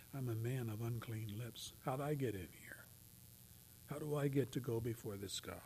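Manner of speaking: humbled murmur. Acted. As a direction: speak as one unworthy. Voicing humbled worshipper.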